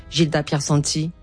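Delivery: ordinary delivery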